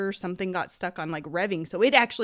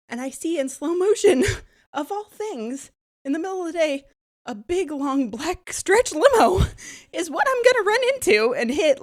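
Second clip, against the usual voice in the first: high, loud and laughing through the retelling; laughing excitement; 9 semitones higher, 7 dB louder than usual. Retold or imitated. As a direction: relive the near crash with nervous laughter. Retelling near-crash, laughing.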